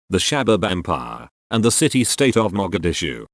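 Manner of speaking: ordinary delivery, nothing marked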